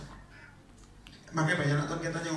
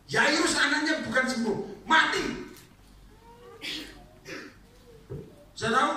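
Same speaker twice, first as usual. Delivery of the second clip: higher, loud preaching peak; fiery preaching; 13 semitones higher, 3 dB louder than usual. Acted. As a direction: preach it out with fire. Preaching at full force.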